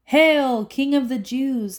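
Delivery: high, loud mock hail. A narrator's reading. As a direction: jeer it up high and loud, sneering. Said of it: "they mocked".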